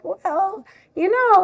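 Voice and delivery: high-pitched, noncommittal "oh well"; mock evasive reply. Acting out her evasive answer to parents.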